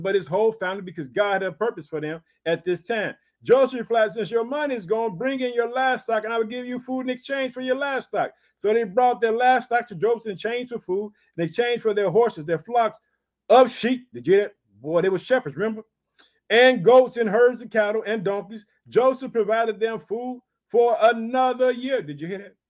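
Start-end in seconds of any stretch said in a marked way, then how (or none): 3.4-14.1 raised, measured Bible reading voice; scripture reading. Acted. Preacher reading Genesis to his congregation, raised and measured. 16.5-22.0 raised, measured Bible reading voice; scripture reading. Acted. Preacher reading Genesis to his congregation, raised and measured.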